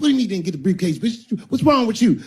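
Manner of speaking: angry pimp. Acted. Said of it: "He said".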